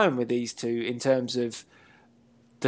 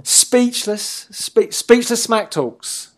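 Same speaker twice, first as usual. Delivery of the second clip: speechless smack talks stretched out, higher and louder; drawn-out announcer call; 7 semitones higher, 4 dB louder, much slower than usual. Pretend. Cohost riffs a show title to his partner, higher, louder, slow announcer drawl. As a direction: hype it up like a ring announcer, slowly. Mock announcer name repeated.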